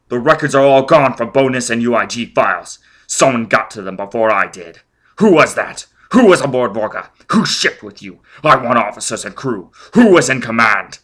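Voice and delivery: shouting down a terrified man; furious interrogation. Acted. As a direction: drive each question home with rage.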